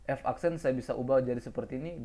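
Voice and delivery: ordinary talk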